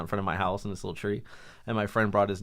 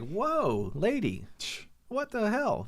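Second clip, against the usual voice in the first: higher, startled whoa; mock shocked; 11 semitones higher, much slower than usual. Friend shocked at the wife's rude outburst, higher mock surprise. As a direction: act taken aback by her.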